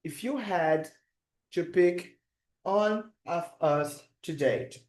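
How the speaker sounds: "If you had to pick one of us to date" read out slowly for the chat; slow, careful teacher read of the sentence; slow dictation. Shown as a demonstration. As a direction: read slowly so students can write it down. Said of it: "Quero saber como é que você colocaria".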